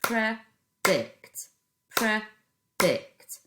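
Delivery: Predict said loudly in two clapped beats; teacher claps syllables for the class. Shown as a demonstration. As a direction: clap out Predict as pre and dict. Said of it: "split it into two syllables and clap it".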